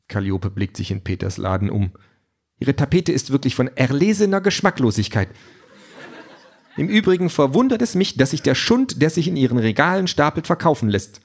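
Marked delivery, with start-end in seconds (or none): 2.6-11.2 higher, snooty android lady; haughty android voice. A narrator's reading. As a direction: sniff at the shabby shop. Android Calliope speaks.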